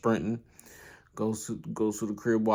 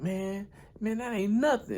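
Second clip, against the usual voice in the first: much higher mocking bully voice; high scoffing bully; 11 semitones higher than usual. Bullies scoff at the boxer in high mocking voices. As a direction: sneer it out like a cocky punk.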